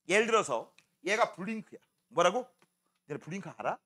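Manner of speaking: usual speaking voice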